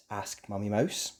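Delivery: ordinary delivery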